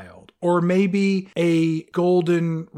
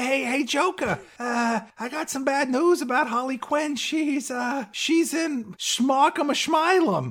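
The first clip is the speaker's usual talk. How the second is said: high, nervous henchman delivering bad news; mock timid goon, pitched up; 8 semitones higher than usual. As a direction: play a jittery goon breaking it to the boss. Goon voiced telling Joker news.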